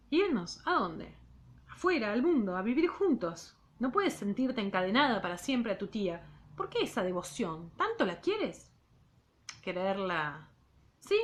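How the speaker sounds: measured literary read-aloud; reading a novel aloud to students; story narration. A narrator's reading. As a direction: read the novel to the class, unhurried.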